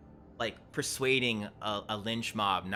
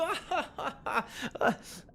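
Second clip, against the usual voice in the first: much higher jeering cackle; mocking crowd laugh. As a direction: laugh at the stranger like the henchmen. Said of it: "couple people start laughing behind him".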